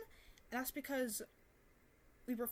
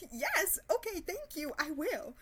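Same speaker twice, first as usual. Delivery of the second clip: high, loud, over-bright sarcasm; sarcastic glee; 6 semitones higher, 7 dB louder, slower than usual. Retold or imitated. Answering his get out with fake cheer. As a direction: chirp it back sarcastically.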